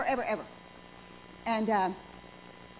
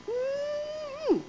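high, sly approving mm-hmm; a playful high-pitched mm-hmm; appreciative mm-hmm; 14 semitones higher than usual. Pretend. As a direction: hum it like you're savoring it. Admiring husband's smell.

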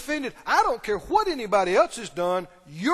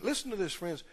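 ordinary talk, nothing marked

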